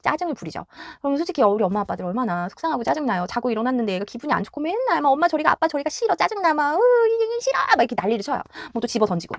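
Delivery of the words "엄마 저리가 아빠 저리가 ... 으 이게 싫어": put-on a higher-pitched, whiny kid pushing parents away; whiny grumpy child. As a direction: fuss like a cranky toddler.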